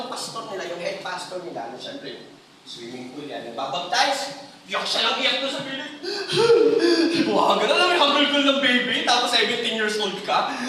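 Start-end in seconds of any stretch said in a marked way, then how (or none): 6.0-7.2 sharp loud high gasp; loud dramatic gasp. Retold or imitated. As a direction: gasp as if overcome. Retelling sobbing scene.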